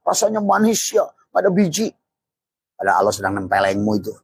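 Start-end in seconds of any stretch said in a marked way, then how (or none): 0.1-1.9 high, gushing mock delight; hammed-up pleasure, much higher; carefree delight. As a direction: gush over the grapes like a careless eater. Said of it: "Tapi dia malah asik".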